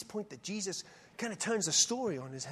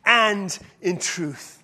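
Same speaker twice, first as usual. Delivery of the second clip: preached climax; 7 dB louder, slower than usual. Acted. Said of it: "preaching builds to climax".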